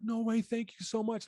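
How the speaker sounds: high, lightly voiced thrilled young girl; emotional little girl. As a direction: play the grateful girl, voice lifted up high. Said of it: "She's getting emotional".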